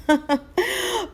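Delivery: high hearty king's laugh; king laughing till he cries; royal laugh. Acted. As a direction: laugh from the belly. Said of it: "He laughed until he held his sides".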